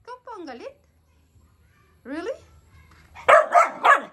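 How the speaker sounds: very high disbelieving telling-off of the dog; high incredulous scolding. Real, not acted.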